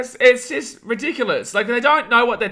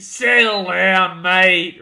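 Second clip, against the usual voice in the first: louder, slow, drawn-out "Settle down"; firm settle down; 4 dB louder, much slower than usual. Real, not acted. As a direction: lean in and stretch "Settle down" to calm him. Repeats it to calm chat.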